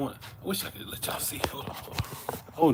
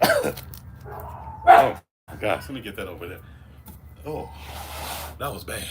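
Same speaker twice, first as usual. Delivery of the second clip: disgusted by smell; 3 semitones lower than usual. Real, not acted. Coughing at the smell.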